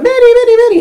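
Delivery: high, repeated chasing call; calling after a dog. Retold or imitated.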